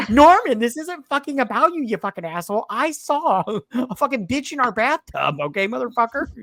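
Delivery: high-pitched hammed-up rant, loud on the name; shrill-pitched mock fury, cracking into laughter; mock outraged wife. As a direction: imitate a wife losing it at her husband. Wife's acted quarrel with Norman.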